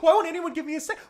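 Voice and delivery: high, loud, whiny incredulity; mock panic. Scientist character begging for a second, mock frantic. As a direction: imitate a flustered actor pleading with everyone. Imitating Wahlberg's panicked outburst in film.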